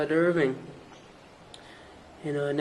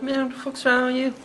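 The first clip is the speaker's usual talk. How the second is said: pitched-up exasperated "what the fuck"; exasperated retort; 10 semitones higher than usual. Retold or imitated. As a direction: throw it back at them, high and outraged. Calling out friends angrily.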